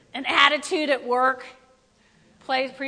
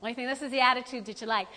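usual speaking voice

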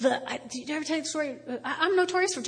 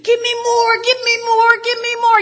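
high, loud begging for more; hammed-up masochist demanding more; mock eager; 9 semitones higher, 10 dB louder than usual. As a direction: plead for pain like a glutton. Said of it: "stand up and say".

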